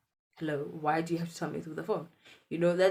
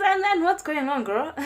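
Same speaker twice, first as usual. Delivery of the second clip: high, loud eager aunt; aunt asks eagerly what is happening; excited aunt; 11 semitones higher, 7 dB louder than usual. Retold or imitated.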